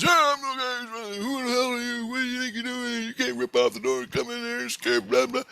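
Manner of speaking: very high-pitched, mocking rant; mock indignant scientist. Lab boss scolds the intruder, mock indignant squawk. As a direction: squawk his scolding in caricature.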